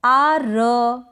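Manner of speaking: आ र split into two slow sounds with a full R; drawn-out आ र, over-pronouncing the R; wrong full R. Shown as a demonstration. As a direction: say आ, then a separate, fully voiced र. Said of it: "ना बोलें".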